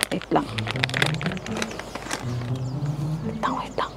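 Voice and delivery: hushed whisper; quiet at night. Real, not acted. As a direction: whisper so he won't wake. Afraid boss wakes.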